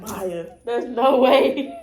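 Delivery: loud, slow, laughing no way; laughing disbelief. Retold or imitated. Telling Maya about the prayer, laughing loudly. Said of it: "I was like".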